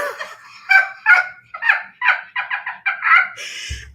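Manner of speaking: shrill laugh tipping into tears; high wailing laugh. Real, not acted. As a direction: laugh until it sounds like crying.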